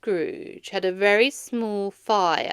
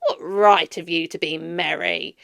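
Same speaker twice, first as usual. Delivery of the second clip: Scrooge scolding; 3 semitones lower, 6 dB louder than usual. A narrator's reading. Scrooge scolds, nephew echoes.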